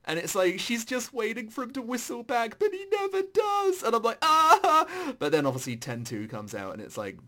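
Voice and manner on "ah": a high, loud anguished "Ah!" at the sad ending; mock anguished cry. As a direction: wail it out, half in jest. Recalls being upset by ending.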